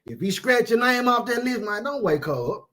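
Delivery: ordinary talk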